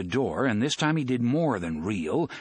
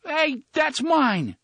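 high, slow, bleating protest; bleating whine; 5 dB louder, much slower than usual. Acted. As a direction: bleat it like a scared sheep. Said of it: "Fat Johnny bleated".

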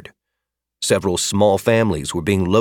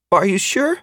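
put-on higher, small child's shaky plea; quavering voice; 12 semitones higher than usual. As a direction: fake a trembling little boy. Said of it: "in what he hoped was a quavering voice".

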